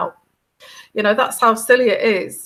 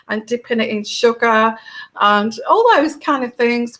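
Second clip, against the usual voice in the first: usual speaking voice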